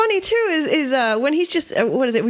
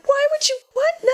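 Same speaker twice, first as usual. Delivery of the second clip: mock disbelief; 13 semitones higher than usual. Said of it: "you're just like".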